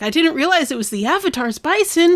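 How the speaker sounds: high-pitched mock whining plea; panicked excuse. Son excuses himself to father.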